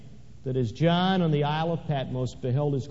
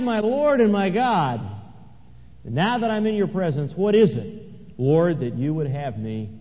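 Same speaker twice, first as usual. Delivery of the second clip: preaching at full force. Acted. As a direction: lift the voice up in worship. Preaching climax.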